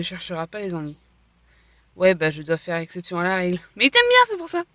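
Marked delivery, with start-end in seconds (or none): 3.8-4.6 much higher, louder teasing. Acted. Teasing a friend about Castiel, much higher voice. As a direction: tease her that he likes her.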